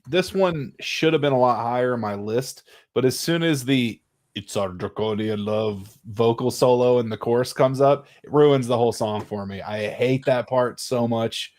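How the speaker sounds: ordinary delivery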